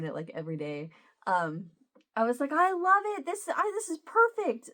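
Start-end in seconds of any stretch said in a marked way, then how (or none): 2.5-4.7 high, excited retold gushing; delighted gushing. Telling Emma the gift is perfect, delighted gushing. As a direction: act out delight, pitch lifted high.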